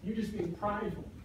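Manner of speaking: low, hushed insinuation; soft, low murmur of accusation; devil's whisper. Acted. Devil murmuring doubt into a believer's ear, hushed and low. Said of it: "he whispers in your ear".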